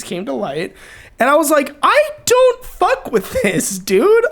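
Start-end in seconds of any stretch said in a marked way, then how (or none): 1.8-4.3 high, slow, drawn-out declaration; emphatic refusal. Retold or imitated. Retelling a turning point to a friend, emphatic refusal. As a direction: put your foot down, pitched well up. Quoting own past resolve.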